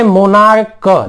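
मोनार्क drawn out, ending in hard "k". Shown as a demonstration. Teacher giving an example, मोनार्क ending in "k". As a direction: slowly sound out मोनार्क with a final क. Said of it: "तो ये मोनार्च नहीं है".

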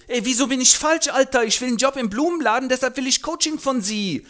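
sehr hoch, laut, prollig; Straßengöre. Acted. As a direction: patzige Göre im Jugendslang. Eliza beim Professor. (hohe, laute Prollstimme)

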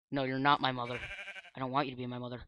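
ordinary talk, nothing marked

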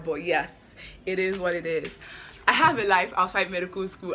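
ordinary talk, nothing marked

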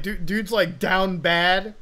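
usual speaking voice

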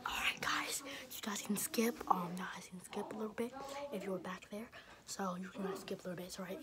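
hushed whisper to camera. Real, not acted. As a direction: whisper so she can't hear. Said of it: "whispering behind grandma's back".

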